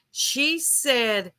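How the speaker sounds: ordinary delivery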